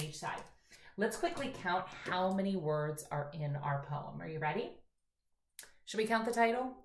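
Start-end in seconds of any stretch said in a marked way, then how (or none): none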